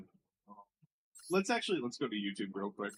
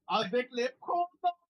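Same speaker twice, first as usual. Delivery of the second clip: high, hammed-up French accent; mock French; 10 semitones higher than usual. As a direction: sneer it like a snooty Frenchman. Mocking French phrase again.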